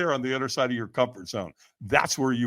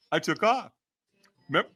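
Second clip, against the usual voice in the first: ordinary talk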